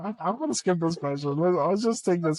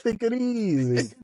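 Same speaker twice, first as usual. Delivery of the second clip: put-on slow Nacho Libre style Mexican accent, pitched up; 6 semitones higher, slower than usual. Husband showing his Nacho Libre take it easy, slow, high Mexican accent. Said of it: "with the accent".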